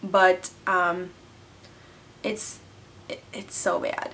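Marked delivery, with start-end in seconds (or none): 2.2-4.1 whispered, softer confession; guilty whisper. Real, not acted. Confessing game addiction.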